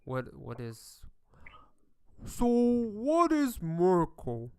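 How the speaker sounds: confused dwarf. Acted. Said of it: "You heard one of them say".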